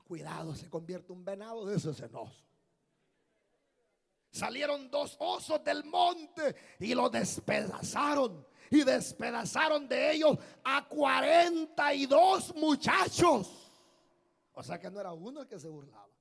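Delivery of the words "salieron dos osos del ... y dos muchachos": high, shouted, slow and drawn-out; thundering scripture reading. Real, not acted. Preacher warning mockers with the bears' attack, thundering shout. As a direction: thunder each phrase, building to the last word.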